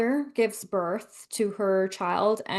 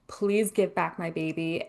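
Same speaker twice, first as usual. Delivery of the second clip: ordinary delivery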